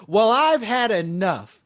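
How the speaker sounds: loud, high, drawn-out mock outrage; fed-up heckler. Audience member announcing she is leaving the show, mock indignant. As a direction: imitate an indignant walkout, slow and emphatic. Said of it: "making it much sweeter than what she did".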